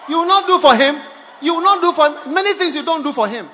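loud, high contradiction; shouted rebuttal. Real, not acted. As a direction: cut in with a forceful shout. Preacher heckles the acted declaration.

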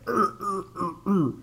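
high, strained grunting; mock effortful grunts; straining grunts. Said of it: "and you're like".

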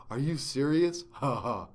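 lightly put-on dopey antagonist; mock a rival fan with a hint of a character voice. Host quoting a rival fan online, mocking antagonist voice. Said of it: "antagonist voice is always like a low".

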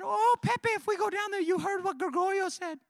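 put-on high, loud Colombian girl's voice. Preacher voicing Luisa, high and pleading. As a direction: squeak it out like young Luisa.

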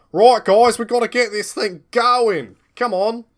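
loud, high rallying bark; rally the henchmen with a big shout; villain boss shouting. Acted.

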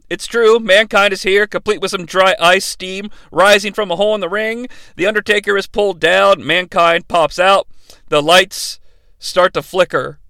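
usual speaking voice

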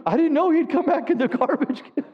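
words bubbling through laughter; retelling the widow's joke while laughing; laughing while talking. Retold or imitated. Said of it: "she was laughing as she was saying".

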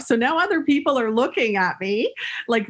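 usual speaking voice

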